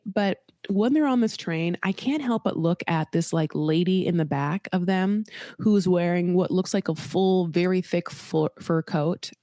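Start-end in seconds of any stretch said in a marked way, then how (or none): none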